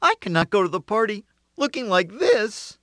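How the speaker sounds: high, fretful rooster voice; young rooster fretting, pitched high; upset rooster. A narrator's reading. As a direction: whine it like a fussy rooster.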